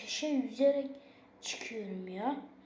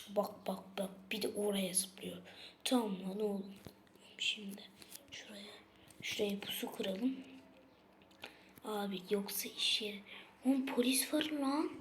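hushed, plotting whisper; sneaky whisper; 7 semitones lower, 11 dB quieter than usual. Acted. Whispering to his friend while setting an ambush, hushed.

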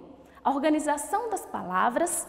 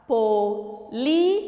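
"Polícia" em pedaços, com pausas; separando as sílabas; 3 semitones lower, much slower than usual. Shown as a demonstration. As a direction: diga "Polícia" sílaba por sílaba. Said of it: "Quatro sílabas". ("Polícia" silabado devagar: po, li, ci-a)

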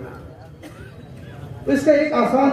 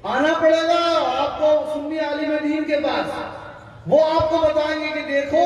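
raised, insistent sermon voice; preaching; 6 semitones higher than usual. Acted. Preacher to the congregation on prayer times, raised sermon voice. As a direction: press the point on the congregation.

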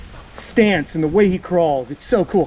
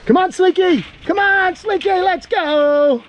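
higher sing-song coaxing of a pet; coaxing pet talk; 12 semitones higher, slower than usual. Real, not acted. Owner coaxing his lizard toward the new house, higher sing-song. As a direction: coax the lizard along like a puppy.

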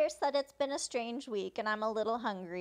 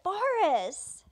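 higher, louder exclaimed name; exclamation; 7 semitones higher, 6 dB louder than usual. Retold or imitated. She reacts to Boris, exclaiming. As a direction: cry out the name with a falling swoop. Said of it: "Exclamation mark".

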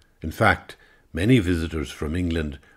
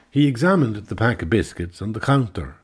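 plain reading voice

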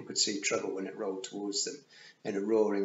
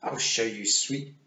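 lightly voiced menacing Queen; slower than usual. A narrator's reading. The Queen slowly threatens Tiffany, a hint of menace. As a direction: draw out the menace a little.

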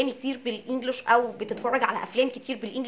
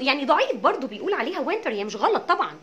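ordinary delivery

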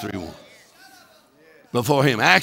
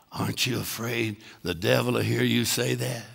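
mock hushed voice, softer; retold whisper; 8 semitones lower, 10 dB quieter than usual. Preacher murmuring in Hagin's ear, mock hushed voice. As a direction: lean in and ask it softly, like a secret. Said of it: "whispered in his ear".

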